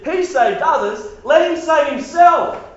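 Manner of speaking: mocking high taunt; taunting sneer from the rulers. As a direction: sneer it at him. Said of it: "the rulers even sneered at Jesus".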